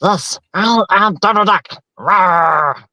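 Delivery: high, squawky cartoon duck voice; Donald Duck voice. Shown as a demonstration. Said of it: "it'll kinda run a little bit like this".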